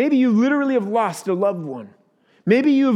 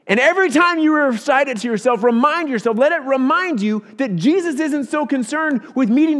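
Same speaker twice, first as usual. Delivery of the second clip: higher-pitched, emphatic sermon delivery; impassioned preaching; 6 semitones higher, 4 dB louder than usual. Real, not acted. Pastor urging congregation to remember Jesus meets their need, impassioned preaching. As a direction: preach it with urgent conviction.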